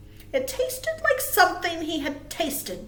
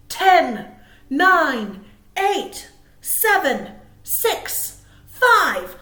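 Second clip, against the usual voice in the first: counting down louder and higher to a big two; countdown; 6 semitones higher, 12 dB louder, much slower than usual. Acted. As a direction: build the countdown to a shout. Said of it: "Can you count with me?".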